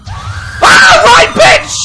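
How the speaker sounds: very high, loud battle yell; furious shout. Real, not acted. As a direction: scream it as you strike back. Fighting back in game.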